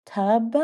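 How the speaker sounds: tub with a trailing uh sound, questioning; imitating the boy's tub-uh, rising; kid's tub-uh. Little boy answering the teacher saying tub-uh. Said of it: "This is him".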